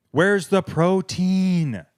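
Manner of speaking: slow outraged. Acted. Repeated in outrage.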